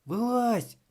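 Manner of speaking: high, cheerful call. A narrator's reading. Thomas inviting Vroni onto the bull, high call. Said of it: "гукнув Томас".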